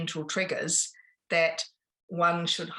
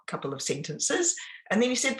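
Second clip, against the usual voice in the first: ordinary delivery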